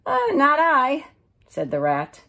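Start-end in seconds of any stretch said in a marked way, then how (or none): 0.1-1.1 a squeaky, bored refusal; high lazy rat. Acted. The rat turning down the hen, squeakily.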